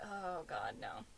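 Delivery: low, soft groan of dismay; groaned dread. Acted. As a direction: sink down low in weary dread.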